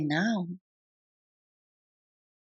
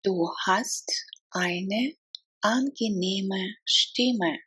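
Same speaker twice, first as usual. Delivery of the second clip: word by word. Shown as a demonstration. Teacher models a compliment on a voice, word by word. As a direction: break the phrase up carefully. Language drill, broken into words.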